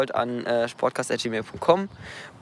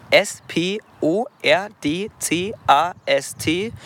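slow, each letter said separately; spelled out letter by letter; 3 semitones higher, much slower than usual. Shown as a demonstration. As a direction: spell it out slowly for dictation. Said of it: "unser Podcast wird ja Sportcast heißen".

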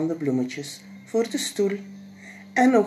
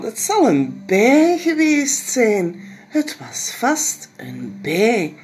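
reassuring mama; 6 semitones higher, 6 dB louder, slower than usual. Acted. Mama comforting, slow.

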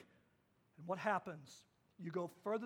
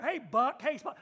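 loud casual call to a dog; 3 semitones higher, 8 dB louder than usual. Pretend. Teen calling his dog, loud and easy. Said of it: "Wouldn't that be a little better".

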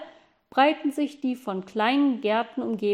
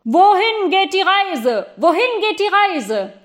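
powerful voice; 8 semitones higher, 8 dB louder than usual. Acted. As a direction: boom it up from the park. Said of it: "eine kraftvolle Stimme".

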